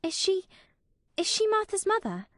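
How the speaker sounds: put-on timid girl's high, halting question; Mary, stammering. A narrator's reading. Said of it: "she stammered".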